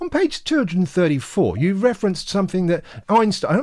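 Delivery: high, mock-earnest reader quoting page numbers; a hammed-up reader reciting page numbers at the author; mimicking fussy reader. Mimicking people quoting his book.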